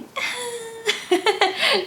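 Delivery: high-pitched fake sobbing; hammed-up high wail, teasing; mock crying. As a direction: imitate someone pretending to cry. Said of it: "trying to act like she was crying".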